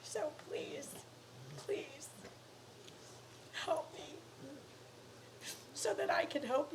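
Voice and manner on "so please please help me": hushed, begging please; soft pleading whisper. Real, not acted. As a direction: whisper the plea softly. Begging for help.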